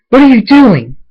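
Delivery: high, loud outraged question; shocked shout. A narrator's reading. Pinkie confronting Thunderstorm, shocked high shout. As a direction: cry out at what you have found.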